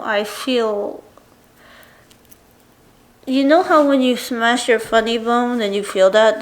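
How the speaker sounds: ordinary talk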